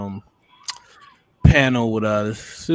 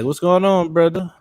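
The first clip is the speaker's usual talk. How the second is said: mock African accent; 4 semitones higher than usual. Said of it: "Terrible, terrible accent".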